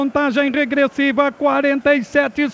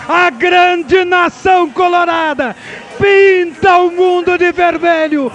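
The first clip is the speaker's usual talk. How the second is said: shouted radio championship call; victory call; 4 semitones higher than usual. Real, not acted. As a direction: belt out the title win. Radio goal call celebration.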